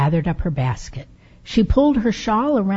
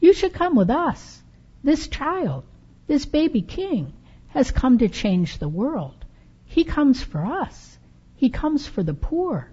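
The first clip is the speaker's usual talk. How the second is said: put-on higher, earnest young boy's voice; eager boy; 7 semitones higher than usual. Camel boy urging Befana to join, higher eager voice. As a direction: plead with her to come along.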